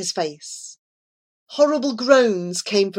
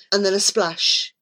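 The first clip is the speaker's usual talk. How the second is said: usual speaking voice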